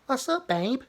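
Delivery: high, sugary imitation of a sympathetic reply; mock reply. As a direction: coo it mockingly like a fawning partner. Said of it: "What's up, babe?" is your, you've got an issue".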